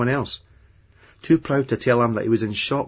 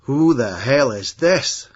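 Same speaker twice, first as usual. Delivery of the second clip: higher, louder, slow outraged disdain; 4 semitones higher, 5 dB louder, slower than usual. A narrator's reading. American demanding who Terry is, in loud outraged disdain. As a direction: sneer it out in outraged disbelief. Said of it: "in outraged disdain".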